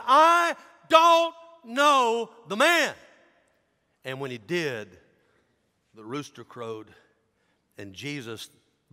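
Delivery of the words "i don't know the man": slow, high, furious shout; cursing denial. Acted. Disciple cursing as he denies Jesus, furious shout. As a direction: yell it one drawn-out word at a time. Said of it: "And he cursed".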